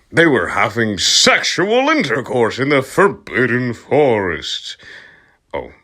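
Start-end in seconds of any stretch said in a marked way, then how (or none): none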